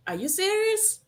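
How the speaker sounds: ordinary delivery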